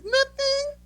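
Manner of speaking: very high tearful squeak; mock crying. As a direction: imitate a crying girl squeaking out a denial. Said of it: "I saw a teenage girl cry".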